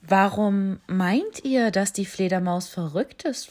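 put-on owl questioning the young animals; wise owl. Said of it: "fragte die Eule". (langsame, ruhige Eulenstimme)

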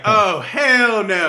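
slow, high, drawn-out "hell no"; mock her sassy outrage, stretching every word; drawn-out indignant "oh, hell no"; sassy refusal. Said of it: "She was like".

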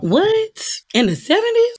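very high, incredulous questions; astonished rising pitch; mock disbelief. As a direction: squeal it as if you can't believe it.

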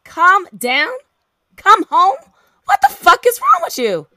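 loud, high, incredulous echo building to fury; furious incredulous outburst; outraged disbelief. Acted. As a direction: fling the words back, then explode. Outraged echo in phone quarrel.

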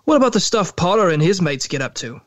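resentful, pressing demand. A narrator's reading. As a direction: push the question at her resentfully. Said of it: "demanded Snape".

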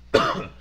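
dry throat cough; real cough clearing the throat. Real, not acted. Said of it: "excuse me".

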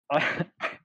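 badly faked, short put-on chuckle; Seth Rogen. Shown as a demonstration. Trying an impression under pressure, forced laugh. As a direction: attempt a signature laugh and miss. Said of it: "I'm gonna try it".

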